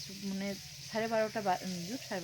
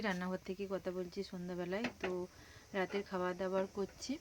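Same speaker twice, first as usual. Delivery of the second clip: normal talking voice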